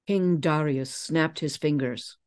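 ordinary delivery

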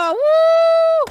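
high, loud whoop of glee; thrilled yell; excited whoop. Real, not acted. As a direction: whoop it out as you take off. Flying the airplane, whooping.

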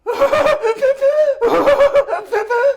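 high, huffing fake sobs; demonstrated stage crying. Shown as a demonstration. Coach shows how Necla should cry, high mock sobbing. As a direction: show exaggerated melodramatic weeping in bursts. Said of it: "Canlı gösterim".

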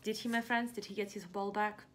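usual speaking voice

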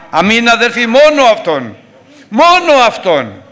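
high, loud thundering sermon; fiery preaching. Acted. Preacher warns the faithful who stays outside, fiery and loud. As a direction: preach it with full force.

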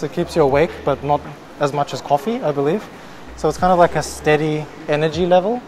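muffled behind mask; speaking masked in store; through a face mask. Real, not acted.

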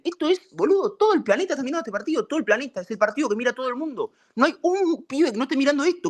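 high-pitched, indignant rant; heated, high, exasperated protest; furious disbelief. Real, not acted. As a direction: rant in outrage with the voice pushed right up. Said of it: "estoy re caliente".

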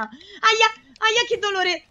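high-pitched wincing cries; squealed sympathy pain; pained yelps. Real, not acted. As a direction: yelp as if hurt yourself. Reacts to on-screen injury.

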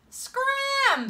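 very high-pitched send-off; dismissive order. A narrator's reading. Kid chasing boy off the playground, high and dismissive. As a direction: shoo him off in a high kid's voice. Kid sends the child away.

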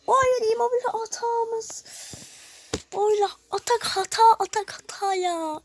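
isyan eden çığlık. Real, not acted. Gol yenince isyan ediyor.

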